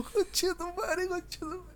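hushed amazed cursing; awed whisper. Retold or imitated. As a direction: breathe it out in disbelief. Stunned reaction at the cinema.

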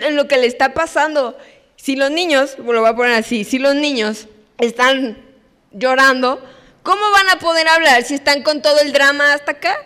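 ordinary delivery

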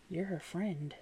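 soft hushed voice; hushed quote. Retold or imitated. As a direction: say it under your breath. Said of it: "It was like".

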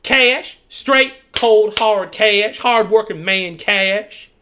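drawn-out Southern twang; put-on Southern accent. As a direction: relish it in a Southern drawl. Lists prizes in the Southern accent.

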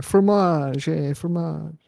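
a lightly put-on French accent, drawn out; hammy French accent saying "Fromage"; fake French accent. Shown as a demonstration. Host demonstrating an easy fake French accent, hammy French accent. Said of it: "it's pretty easy to fake a French accent".

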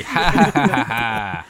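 high, loud gloating ha-ha-ha; villain laugh. Retold or imitated. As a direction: cackle like a gleeful villain. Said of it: "he has the best, like, villain laugh".

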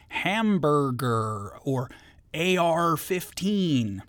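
"hamburger" and "A R fifteen" drawn out on heavy R's; over-pronounced R's in "hamburger" and "A R fifteen"; hard American R's. Shown as a demonstration. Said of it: "really hit those R's as hard as you can".

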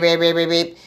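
mimicked alarm beeping, flat and steady; alarm beeps. Door opened, mocking the alarm beeping. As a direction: imitate the hospital alarm going off.

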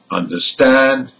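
"understand", weight falling on STAND; stress on STAND. Shown as a demonstration. Teacher repeats the verb for learners, "understand" stressed on STAND. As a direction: punch the second part of "understand", STAND. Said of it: "stand in number one".

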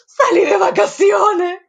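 slow, loud, triumphant announcement; excited. Real, not acted. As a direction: proclaim it with a cheer. Announces her vacation.

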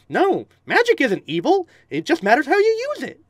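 put-on high-pitched innocent kid; high, earnest ten-year-old; innocent child necromancer. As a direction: protest innocently in a little boy's voice. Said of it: "play an innocent necromancer".

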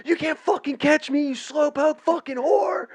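high mocking taunt; high-pitched childish taunt. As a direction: jeer over your shoulder while running. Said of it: "I started cussing her out".

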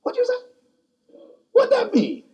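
high, slow astonished question; stunned. Retold or imitated. Preacher stunned by what God told him, high astonished question. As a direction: gasp it out in disbelief, way up high. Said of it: "And I stopped crying".

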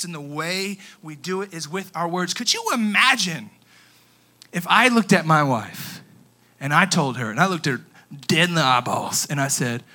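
normal talking voice

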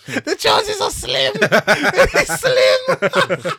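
slow, high, laughing delivery; dramatic drawn-out. Acted. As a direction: stretch it out with a laugh. Joking about uni relationships.

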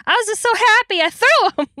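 high, loud fake elation; pretend delight. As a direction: gush with put-on happiness. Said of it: "Did you pretend you were s-, just like".

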